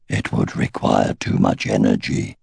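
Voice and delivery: very low, resonant ancient dragon; deep dragon voice. A narrator's reading. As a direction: rumble it from deep down. Ancient dragon Glader speaks.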